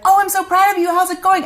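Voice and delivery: high, bright voicing of her husband; proud husband. Husband back from the road praising his wife, high and cheerful. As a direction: play the husband greeting her warmly. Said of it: "he walked in the door and he was like".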